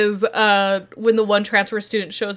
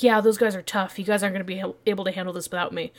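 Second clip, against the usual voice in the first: lower, softer, cocky boast; cool tough thug; 5 semitones lower, 9 dB quieter, faster than usual. Retold or imitated. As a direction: say it low and self-assured.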